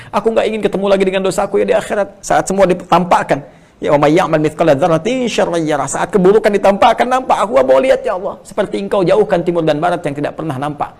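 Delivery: rising fervent plea, voice lifted; impassioned prayer. Acted. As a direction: cry out in fervent supplication.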